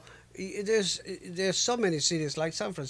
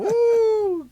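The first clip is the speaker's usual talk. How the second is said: high, loud whoop; excited whoop; 10 dB louder than usual. Real, not acted. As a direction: let out a wild woo.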